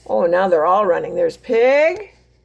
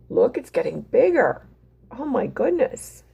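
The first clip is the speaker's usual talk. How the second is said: ordinary delivery, nothing marked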